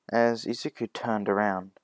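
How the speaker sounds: plain reading voice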